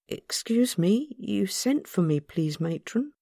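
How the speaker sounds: a polite schoolgirl asking carefully; young girl. A narrator's reading. The girl asking matron why she was sent for, timid.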